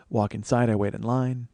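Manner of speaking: ordinary talk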